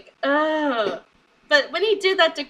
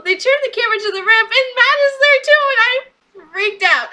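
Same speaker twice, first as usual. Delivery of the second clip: much higher and louder, rushing then slowing; real excitement; 9 semitones higher, 6 dB louder than usual. Real, not acted. Fan reliving the Hardys' return, genuinely excited. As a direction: let the thrill burst out.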